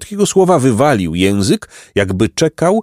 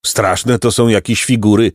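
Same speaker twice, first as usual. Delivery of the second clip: plain reading voice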